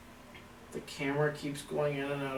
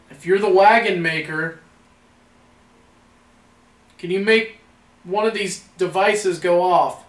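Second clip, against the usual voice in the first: loud, higher call with pauses between phrases; 5 semitones higher, 12 dB louder than usual. Real, not acted. Ghost hunter asks the wagon maker for a sign, calling out. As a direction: address an unseen presence in a raised, carrying voice.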